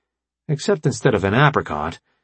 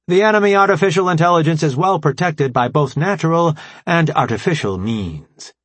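formal AI commander, raised and emphatic; brief the allies in a higher, deliberate voice; Alpha Prime; 8 semitones higher, 3 dB louder than usual. Acted. Voices the AI leader explaining.

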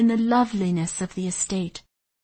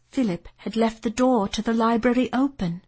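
plain reading voice

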